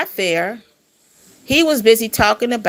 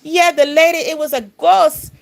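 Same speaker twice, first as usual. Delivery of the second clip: imitating his Jamaican Panamanian accent; 8 semitones higher, 3 dB louder than usual. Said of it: "like, a little accent".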